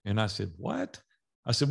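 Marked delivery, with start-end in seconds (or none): 0.6-1.0 sudden high-pitched disbelief, much higher; retold stunned reaction to the contract terms; shocked what. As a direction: jump way up in pitch, caught off guard. Shocked at annual contract demand.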